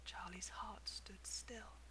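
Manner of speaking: hushed, slow whisper; whispered narration. A narrator's reading. Narrator hushing as the ticket appears, whispered. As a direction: breathe it out in awe.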